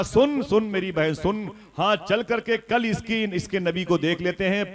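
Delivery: high-pitched, forceful urging; insistent aunt. A narrator's reading. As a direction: act an aunt pressing her sister to listen. Aunt urging sister, replayed louder. (ज़ोर देती खाला की ऊँची आवाज़)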